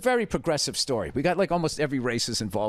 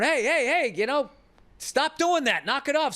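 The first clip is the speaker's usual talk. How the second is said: high scolding call to stop; calling out to a kid; 11 semitones higher than usual. Retold or imitated. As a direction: yell at the kid through the mesh to stop. Yelling at kid through mesh.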